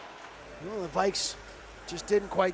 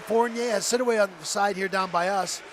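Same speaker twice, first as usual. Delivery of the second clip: usual speaking voice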